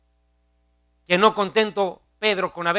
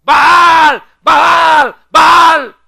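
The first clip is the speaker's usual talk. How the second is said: high, full-force cry to a god; frenzied shouting; 10 semitones higher, 15 dB louder, much slower than usual. Retold or imitated. Prophets crying out to their god at the altar, impassioned shouting. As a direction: bellow it with mounting fervor, high-pitched. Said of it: "con más ímpetu gritaban".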